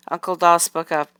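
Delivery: usual speaking voice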